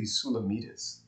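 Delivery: "Sulamitis" said soo-luh-MEE-tis; "Sulamitis" with MEE stressed; alternative pronunciation. Shown as a demonstration. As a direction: say "Sulamitis" with a long ee in the stressed third syllable. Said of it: "some people might say it should be".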